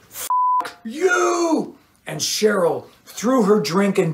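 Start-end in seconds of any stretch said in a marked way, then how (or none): 0.1-1.8 high, cracking yell; furious cry. A narrator's reading. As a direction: hurl it with the drink. Cheryl throws drink at Doug.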